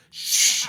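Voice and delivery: long, sharp shush; mimicked theatre shushing; imitated shush. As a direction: shush a noisy neighbour hard. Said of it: "she would go".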